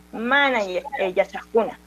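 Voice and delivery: ordinary talk, nothing marked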